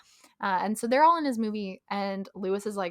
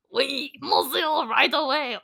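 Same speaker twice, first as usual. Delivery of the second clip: hammed-up French accent, pitched up; bad French accent; 5 semitones higher, 4 dB louder, slower than usual. Tom trying to get on camera, in a bad French accent. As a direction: put on a terrible mock French accent. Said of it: "in an even worse accent than".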